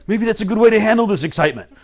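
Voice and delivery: fast, high, loud gleeful self-talk; excited. Acted. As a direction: bubble over loudly, words tumbling out.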